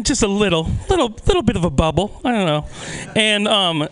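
ordinary delivery

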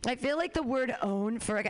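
ordinary delivery

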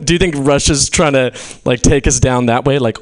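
normal talking voice

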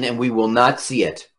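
ordinary delivery

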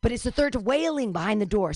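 ordinary talk, nothing marked

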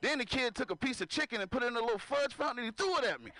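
usual speaking voice

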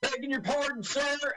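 high-pitched, hammed-up American accent; mock American accent. Doom's man tricking them with his American accent, hammed-up accent. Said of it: "that guy's American accent is excellent".

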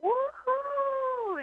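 very high cheering crowd; imitated crowd cheer; crowd woohoo. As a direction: whoop like a packed crowd. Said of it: "the crowd would go like".

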